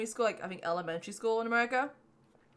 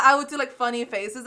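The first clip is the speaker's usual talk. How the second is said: usual speaking voice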